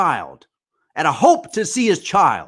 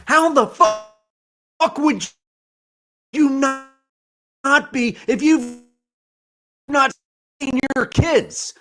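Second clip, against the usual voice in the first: angry; 4 semitones higher than usual. Real, not acted. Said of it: "I'm pissed off".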